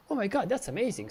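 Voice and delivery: acted amazement. Presenter marvelling that networks communicate with each other, amazed. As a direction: play up mock astonishment at each addition.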